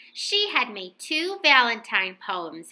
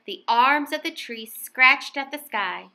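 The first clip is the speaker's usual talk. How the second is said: ordinary delivery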